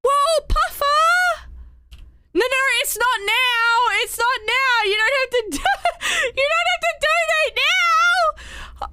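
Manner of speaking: high, overwhelmed squeals; flustered, laughing protest; excited. Real, not acted. Viewers donating, overwhelmed.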